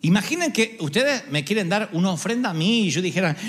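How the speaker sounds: ordinary delivery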